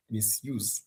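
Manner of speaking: "misuse" said softly and low, stress on "use"; stress on root. Shown as a demonstration. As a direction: repeat "misuse" quietly, weight on the second syllable. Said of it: "That is the word we will stress".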